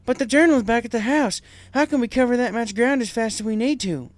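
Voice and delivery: urgent practical worry; AJ worried. Acted. AJ asking how to get there, worried.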